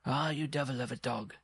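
high-pitched dwarf chiding his dog; scolding dwarf. Acted. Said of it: "the dwarf would cry".

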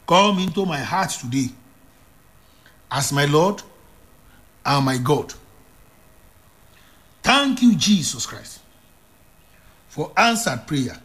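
solemn prayer spoken line by line for repeating; steady solemn prayer voice; prayer lead. Acted. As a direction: lead the people in a sinner's prayer.

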